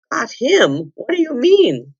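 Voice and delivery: high, incredulous demand; astonished questioning. A narrator's reading. Eustace questions Jill about her catch, high and astonished. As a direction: pitch jumps up in disbelief.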